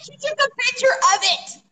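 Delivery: high, emphatic exclamation; worked-up and incredulous; excited insistence. Real, not acted. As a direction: insist on the unbelievable proof. Insisting to a disbelieving listener.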